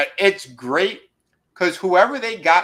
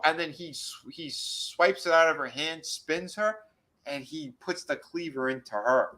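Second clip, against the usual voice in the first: usual speaking voice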